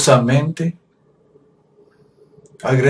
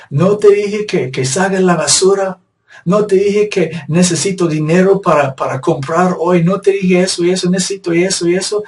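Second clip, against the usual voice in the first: higher, louder, fast scolding nag; wife scolding her husband, mock nagging tone, higher and faster; 6 semitones higher, 3 dB louder than usual. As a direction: play a wife berating her husband, speeding up.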